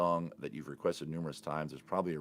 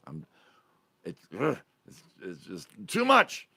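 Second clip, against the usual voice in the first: exasperated; 7 dB louder than usual. Real, not acted. Tired vlogger admitting it's too much, exasperated burst.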